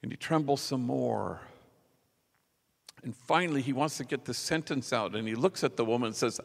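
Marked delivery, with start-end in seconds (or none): none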